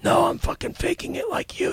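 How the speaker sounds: ordinary talk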